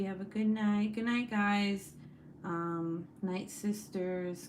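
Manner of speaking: ordinary delivery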